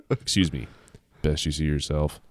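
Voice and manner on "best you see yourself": low, quiet flat sheriff; deadpan lower warning; sheriff's icy flat line, retaken; said flatly. Acted. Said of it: "he said flatly".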